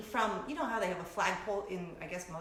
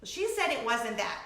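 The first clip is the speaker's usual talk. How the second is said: ordinary talk, nothing marked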